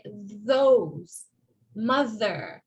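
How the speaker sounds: Those, mother with buzzing voiced TH; tongue between teeth, voice the TH; slow demo of voiced TH in Those and mother. Shown as a demonstration. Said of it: "the voiced T H sound".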